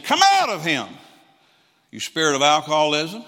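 commanding rebuke. Retold or imitated. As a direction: bark the order like an exorcism. Casting out a spirit.

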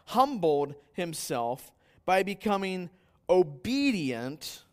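slow, high, loud solemn reading; scripture reading. Acted. Preacher reading Philippians to the congregation. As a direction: proclaim the verses with weight.

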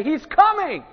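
breathless town crier announcing the groom; excited calling out. Retold or imitated. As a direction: run in shouting the groom is coming. Best man runs into town calling.

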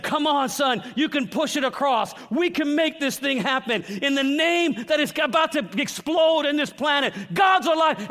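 high, shouted preacher's rallying cry; rousing cheer. Acted. Jesus cheering his son from heaven's grandstands, rousing cheer. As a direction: build it to a full-throated shout.

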